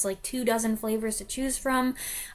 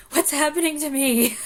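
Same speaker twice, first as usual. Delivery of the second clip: mock bewildered; 5 semitones higher, 5 dB louder than usual. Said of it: "and then I was like".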